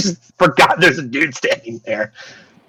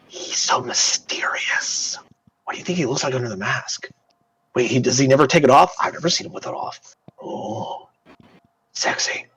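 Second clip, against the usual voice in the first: hushed, breathy gossiping whisper; 6 dB quieter than usual. Acted. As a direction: whisper it so he can't hear. Archivists gossip behind his back.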